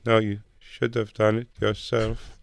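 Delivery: slow, lightly voiced meek refusal; a hint of a prim, meek kid; imitating Carl. Meek classmate refusing to share homework, slow. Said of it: "He goes".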